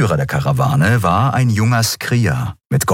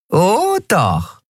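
much higher, gloating voice with a grin; nasty grin; 12 semitones higher than usual. A narrator's reading. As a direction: savour his misery. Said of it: "Nelen grinste fies".

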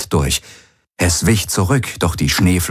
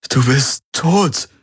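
shaken disbelief; 7 semitones higher, slower than usual. A narrator's reading. Confronting a dead friend's apparition.

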